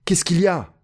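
high-pitched eager question; overjoyed question. A narrator's reading. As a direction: ask eagerly, voice lifted with joy. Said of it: "au comble de la joie". (question joyeuse et aiguë)

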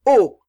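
loud effortful oh; straining grunt. A narrator's reading. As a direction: push out a loud strained cry. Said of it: "en faisant un effort".

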